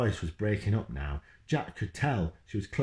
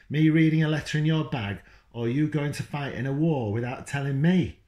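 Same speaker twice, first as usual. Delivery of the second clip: louder, higher accusing demand; angry mum; 7 semitones higher, 5 dB louder than usual. Acted. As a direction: mum confronts dad, furious. Mum shouting at Dad.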